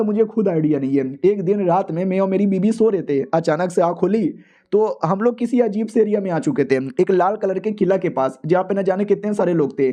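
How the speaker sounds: plain reading voice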